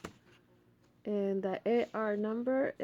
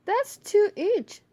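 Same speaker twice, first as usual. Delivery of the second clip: put-on kids counting; 11 semitones higher, 8 dB louder than usual. Children voiced in story.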